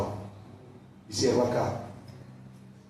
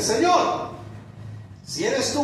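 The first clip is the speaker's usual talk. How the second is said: fervent preaching; 11 semitones higher, slower than usual. Acted. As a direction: call out Peter's plea from the pulpit. Preaching at full force. (predicación exaltada, muy aguda)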